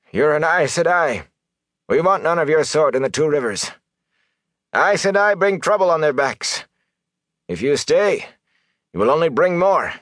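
high, heated villager's denunciation; angry accusation. A narrator's reading. Villager telling an Aes Sedai she's unwanted, angry. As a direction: drive her out with high, angry insistence.

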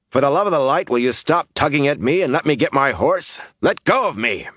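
high, emphatic angry demand; exasperated outburst. A narrator's reading. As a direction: burst out, demanding to be released. Exasperated, struggling to pull free.

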